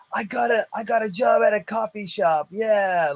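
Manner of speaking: high-pitched mock excitement, imitating a student; excited star student. Star student announcing a new job to the instructor, mock excited. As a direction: announce it high, like a thrilled youngster. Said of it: "and then he's like".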